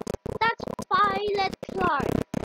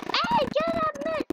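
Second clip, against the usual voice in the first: pitched-up call; high silly voice; 9 semitones higher than usual. Pretend. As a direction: call it out in a squeaky voice. High put-on voice.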